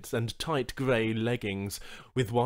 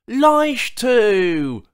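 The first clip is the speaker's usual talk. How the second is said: delighted shout; joyful call; 11 semitones higher, 13 dB louder than usual. Acted. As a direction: call his name out loud and high. Charl greets old associate.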